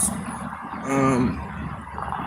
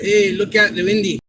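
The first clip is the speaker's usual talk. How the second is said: higher imitated Scottish accent; 6 semitones higher than usual. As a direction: toss off a quick Scottish call. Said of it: "they'll be like".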